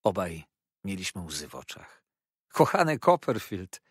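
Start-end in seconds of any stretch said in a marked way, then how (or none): 2.5-3.8 louder, higher cry of affection; emotional exclamation. A narrator's reading. Treadles greets his dear friend Copperfield, emotional cry. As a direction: call out, overcome by the reunion. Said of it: "wołał Treadles we wzruszeniu".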